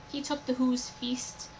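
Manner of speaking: ordinary delivery, nothing marked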